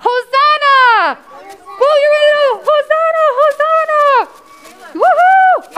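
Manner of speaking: very high, loud crowd-style whoops; jubilant cheering. Shown as a demonstration. Teacher practising with children, jubilant shouting.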